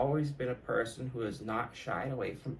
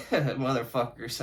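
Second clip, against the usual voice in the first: ordinary talk, nothing marked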